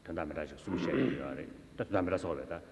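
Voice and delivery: ordinary talk, nothing marked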